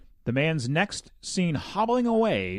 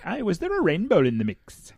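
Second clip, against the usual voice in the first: bad Irish accent; 7 semitones higher, 4 dB louder than usual. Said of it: "that was an awful accent".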